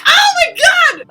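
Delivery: high, loud shriek of surprise; squealing Japanese fans. Retold or imitated. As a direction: shriek it like an overwhelmed fan. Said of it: "they'd be all like".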